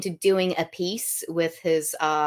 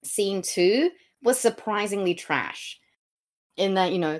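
ordinary talk

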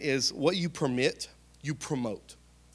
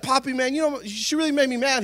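much higher, louder aggrieved whine; whiny young husband; 11 semitones higher, 7 dB louder than usual. Retold or imitated. Young husband complaining to grandpa, high whiny gripe. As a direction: complain like a sulky kid. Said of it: "I'd be like".

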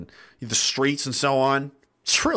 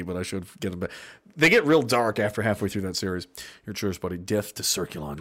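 usual speaking voice

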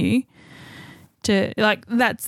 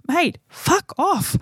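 slow, pitched-up mock snarl; a pretend brush-off, drawn out and higher; mock rude retort; 8 semitones higher, much slower than usual. Teller tells the hovering man off in mock anger, slow and higher. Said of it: "I'm like".

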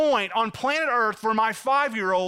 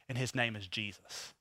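hushed reverence; 10 semitones lower, 12 dB quieter than usual. Real, not acted. Reverent climax naming Jesus.

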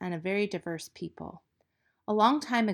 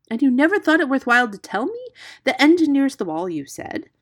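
loud, higher, exasperated; raised voice, incredulous scolding; indignant; 8 semitones higher, 10 dB louder than usual. A narrator's reading. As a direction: rebuke a scatterbrained friend. Hero indignant at withheld news.

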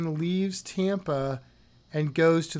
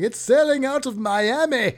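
higher, louder mock exasperated yell; mock listener shout; 7 semitones higher, 6 dB louder than usual. Host imitating frustrated listeners yelling at their device in a mock yell. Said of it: "throwing things at your audio device going".

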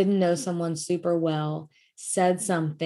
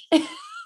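laugh. Real, not acted. Laughs about bawling.

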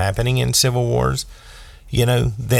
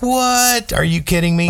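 high, incredulous outburst; stunned disbelief; 12 semitones higher than usual. Retold or imitated. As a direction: sound like your mind was just blown. Recalling mind blown by comic.

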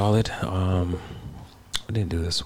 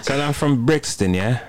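fake London accent; 7 semitones higher than usual. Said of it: "lying about my accent".

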